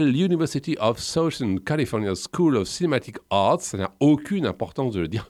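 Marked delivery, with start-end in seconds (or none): 0.0-3.6 showing off an English accent on the American school name; English accent flourish on "University of Southern California". Shown as a demonstration. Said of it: "ça me permet d'admirer mon accent anglais".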